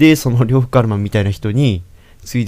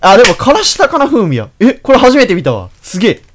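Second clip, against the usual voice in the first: excited; 10 semitones higher, 7 dB louder than usual. Real, not acted. Excited over souvenir ramen.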